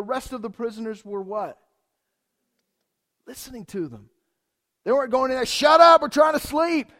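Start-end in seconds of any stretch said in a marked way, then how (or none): 5.4-6.9 loud, high grumpy shout; mock angry yell. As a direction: bark at them to pipe down. Imagined prisoners yelling at singers.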